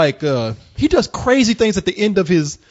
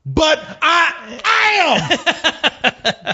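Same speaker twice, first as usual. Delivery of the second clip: mock preacher; 9 semitones higher, much slower than usual. Preacher says it into camera.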